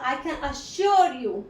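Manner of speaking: "assure" stretched out and emphasized; slow phrase, leaning on "assure"; stress on assure. Shown as a demonstration. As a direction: make "assure" the strongest word. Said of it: "assure के ऊपर मैंने stress डाला".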